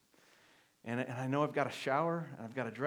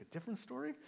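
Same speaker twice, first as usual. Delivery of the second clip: surprised; 9 semitones higher, 9 dB quieter, faster than usual. Retold or imitated. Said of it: "I was like".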